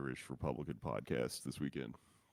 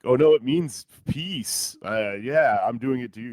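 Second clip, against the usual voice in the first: booming, slow, hammed-up fake explanation; loud and slow, like lying with a straight face; mock loud quote; 8 semitones higher, 14 dB louder than usual.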